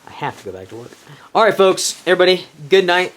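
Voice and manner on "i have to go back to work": resigned, much softer. Real, not acted. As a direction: drop to a defeated murmur.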